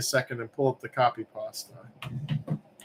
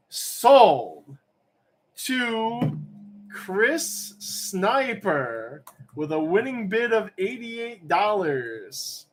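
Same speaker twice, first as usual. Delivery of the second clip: drawn-out, higher-pitched winner announcement; auctioneer's sale call; 7 semitones higher, much slower than usual. Pretend.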